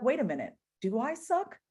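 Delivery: rising, doubtful questions; questioning yourself. Retold or imitated. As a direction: gently challenge the thought, pitch climbing high. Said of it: "and be like".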